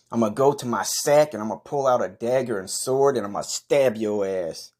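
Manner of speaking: ordinary delivery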